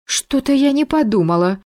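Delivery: very high, sheepish voice; flustered. A narrator's reading. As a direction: squeak out an embarrassed admission. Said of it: "прошептала та". (смущённо, тоненьким голоском)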